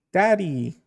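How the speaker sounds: put-on high little-kid cry for Dad; child calling out. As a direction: call for your father like a small child. Child calls for father.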